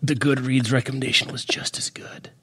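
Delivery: breathy whispered aside. Pretend. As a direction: whisper it like a secret. Joking dying words, whispered.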